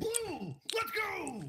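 high, delighted shout; excited cheer. Real, not acted. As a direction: cheer like you just won. Cheering a lucky blue bean.

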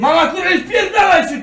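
high, loud yell at mother; exasperated, real yelling; angry shout. Real, not acted. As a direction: shout at mum to keep out. Snapping at mother to keep out.

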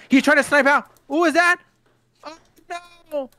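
high, loud, fast call; excited commentary. Real, not acted. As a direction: build up to the shout.

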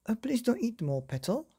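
high, pleading father's voice. Acted. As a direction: beg his daughter to save the biscuits. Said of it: "pleaded Father".

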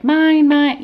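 mimicking a toddler grabbing toys; whiny toddler. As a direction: squeal like a possessive toddler. Kids fighting over toys.

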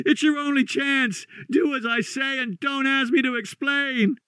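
very high, frantic warning; high-pitched, panicked urging; wild consternation. Acted. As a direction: beg them desperately to flee. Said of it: "in a pitch of wilder consternation".

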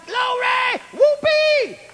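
very high, jubilant scream; hollering for joy. Retold or imitated. Preacher reenacting his joy at the reprieve, hollering. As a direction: whoop it out in revival ecstasy. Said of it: "I hollered, I screamed, I went".

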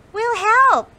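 high, loud squeaky offer; cheerful mouse. Acted. Sam the mouse eagerly offers Molly help, high and loud. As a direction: chirp it out like an eager little mouse. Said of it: "smiled Sam".

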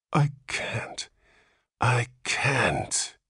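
whispered, despairing moan. A narrator's reading. Father dreading the walk home, moaning. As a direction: breathe it out hopelessly. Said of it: "he moaned".

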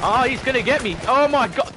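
high, loud in-game panic; panicked yell. Real, not acted. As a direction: shout in alarm as the enemy closes in. Under attack in the game.

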